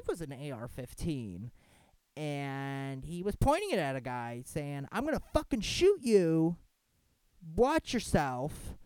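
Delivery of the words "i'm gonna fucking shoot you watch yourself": louder, higher mock threat, slow on the last words; imitating an angry cop. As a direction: retell the cop's snarled threat. Officer threatening man, retold.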